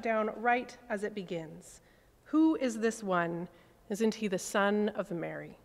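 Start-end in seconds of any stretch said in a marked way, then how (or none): none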